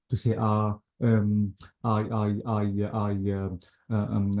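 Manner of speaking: halting, stumbling over uh and um; hesitant stammer. Shown as a demonstration. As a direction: stammer like you can't find the words. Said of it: "this is the meaning of hesitation".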